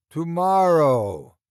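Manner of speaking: slow, loud, drawn-out boom. Acted. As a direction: boom it out slowly to fill the empty room. Mock deep voice in empty room.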